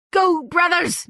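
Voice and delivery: high urgent call to his kin; rally the brothers; high creature call. A narrator's reading. Creature urging flight.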